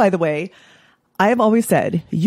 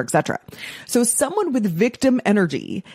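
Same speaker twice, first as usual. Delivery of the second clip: ordinary delivery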